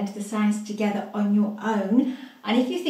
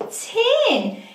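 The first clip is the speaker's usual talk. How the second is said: much higher, bright reveal of the word; excited reveal; 11 semitones higher than usual. Shown as a demonstration. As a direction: announce tin high and happy. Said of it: "Let's read it together".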